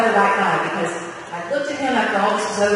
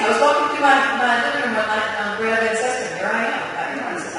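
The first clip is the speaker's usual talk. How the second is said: normal talking voice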